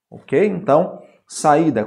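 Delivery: ordinary talk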